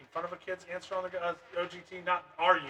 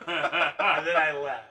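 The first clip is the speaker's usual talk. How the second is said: laughing; 6 dB louder, much slower than usual. Real, not acted.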